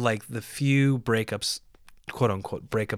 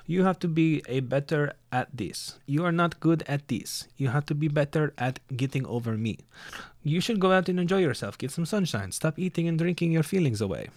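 higher-pitched mock voice of an ex; imitating ex-girlfriend; 4 semitones higher than usual. Ex telling him to get over her, imitated scolding. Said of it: "And she's like".

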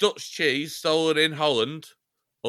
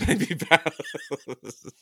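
laughing; 4 semitones higher, much slower than usual. Real, not acted.

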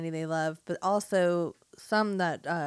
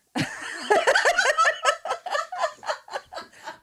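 big loud laugh; loud high laugh; 4 dB louder than usual. Real, not acted. Laughs at own joke.

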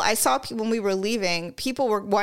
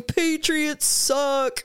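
long drawn-out, higher mock yell; imitated heckler yell; 5 semitones higher, much slower than usual. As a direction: imitate a heckler calling out, without real volume. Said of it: "some fucking asshole starts yelling".

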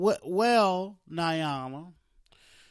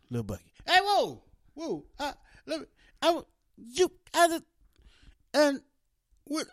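high, loud excited calling; high-pitched hollering character; 12 semitones higher, 5 dB louder than usual. Acted. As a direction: yell up high, all eager. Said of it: "playing the parts".

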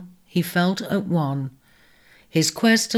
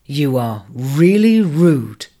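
slow, stern rebuke; 3 semitones lower, 5 dB louder, slower than usual. A narrator's reading. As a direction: look him in the eye and scold. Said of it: "looked him sternly in the eye".

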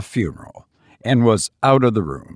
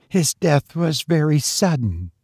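higher-pitched woman's line, measured; woman character speaking; 5 semitones higher than usual. A narrator's reading. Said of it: "said she".

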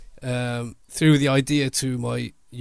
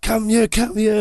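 higher, louder call; 8 semitones higher, 4 dB louder than usual. Retold or imitated. Calling the girl into the frame, loud and high. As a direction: wave her over by voice.

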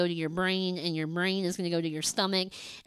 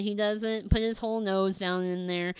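normal talking voice

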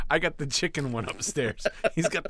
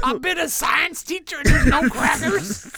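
high, loud squawky parrot rant; 13 semitones higher, 9 dB louder than usual. Pretend. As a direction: demand crackers like an angry bird. Costumed kid demanding crackers.

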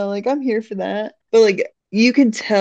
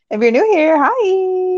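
excited greeting; 11 semitones higher, 4 dB louder, slower than usual. Real, not acted. Excited welcome to new listeners.

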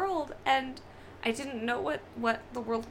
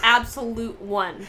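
"absolute one" slow and louder; emphatic ranking; 8 dB louder, slower than usual. Real, not acted. Cohost ranking the movie, slow emphatic declaration. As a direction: lean hard on "absolute one".

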